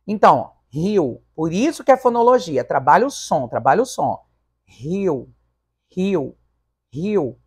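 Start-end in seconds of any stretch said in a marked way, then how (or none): 4.6-7.4 "Rio, rio, rio" with i and o as separate syllables; each "rio" isolated, its two vowels pulled apart; pause between vowels. Shown as a demonstration. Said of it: "Há uma pausa entre o i e o o".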